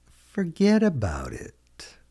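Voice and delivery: "Forget about it" stretched out, high then falling; slow model repeat. Shown as a demonstration. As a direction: draw out "Forget about it" slowly for learners. Teacher repeats phrase slowly for learners.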